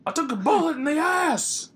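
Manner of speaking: very high, loud holler; mock outraged shout. Outraged customer yelling at a checkout machine, mock outraged shout. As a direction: escalate the indignant rant to a hammed-up yell.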